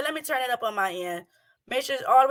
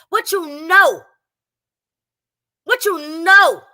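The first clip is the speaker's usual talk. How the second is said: mock shouting; 4 semitones higher, 8 dB louder, slower than usual. Mocking the woman in the video.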